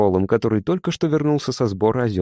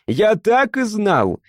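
higher, slow, drawn-out triumphant yell; 10 semitones higher, 3 dB louder, much slower than usual. A narrator's reading. Mishka repeats his triumph to Frol, higher and slow. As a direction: drag out the triumphant cry. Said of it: "заорал Мишка и даже подпрыгнул".

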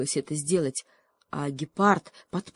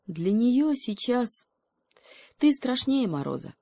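sad hedgehog; 7 semitones higher than usual. Acted. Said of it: "грустно сказал ёж".

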